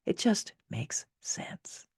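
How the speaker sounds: slow, hushed whisper. Real, not acted. Author confiding to listeners in a slow whisper. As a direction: lean in and whisper it.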